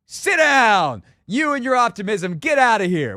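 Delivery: high, loud mock shout at an optimist; mock dismissive yell. Host imitating a scornful pessimist, mock shout. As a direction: bark it like shooing someone out of the room. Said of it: "There is no reason to be like".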